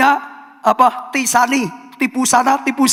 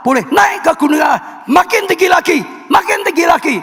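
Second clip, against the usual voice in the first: rising, shouted exhortation climbing higher; fiery preaching; 6 semitones higher than usual. Acted. Preacher urging the congregation to stay pure, shouting upward. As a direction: preach it up to a peak, calling them upward.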